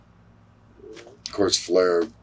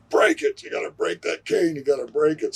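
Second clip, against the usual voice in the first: high yelled urging from outside; retold yelling; 8 semitones higher than usual. Manager yelling at the wrestler to break the cane. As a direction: shout it from outside the ring. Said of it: "Gene is yelling from the outside".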